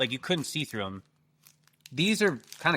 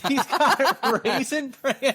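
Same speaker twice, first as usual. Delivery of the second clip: high, laughing through words; can't stop laughing; 11 semitones higher, 4 dB louder than usual. Real, not acted. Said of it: "I can't stop laughing".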